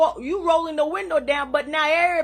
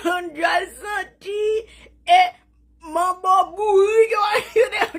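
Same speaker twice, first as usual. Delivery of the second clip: hysterical laughter; 6 semitones higher than usual. Real, not acted. Mocking the rivals to her viewers, laughing hysterically. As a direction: laugh until the words fall apart.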